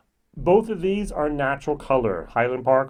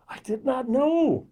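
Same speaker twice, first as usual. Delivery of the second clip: mock amazement; 11 semitones higher, slower than usual. Real, not acted. Marvelling at a new fact.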